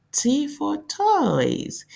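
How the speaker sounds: high, slow and sing-song; cheery teacher model. Shown as a demonstration. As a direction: sell the word to little kids. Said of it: "teacher modelling".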